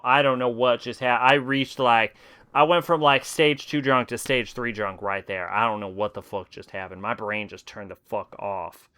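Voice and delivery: ordinary delivery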